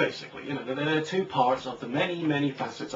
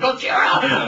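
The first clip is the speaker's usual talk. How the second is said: "Gojira!" bellowed loud and high; monster roar shout; 12 semitones higher, 11 dB louder, slower than usual. Pretend. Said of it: "Gojira, see".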